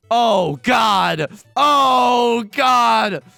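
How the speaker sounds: loud, high wail of dread; panicked cry. Real, not acted. Dreading the tile draw.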